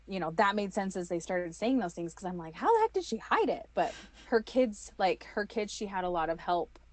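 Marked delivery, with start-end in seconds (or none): 2.6-3.7 high-pitched retold disbelief; hammed-up bewilderment; incredulous question. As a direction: ask it in high-voiced bafflement. Retells her own disbelief.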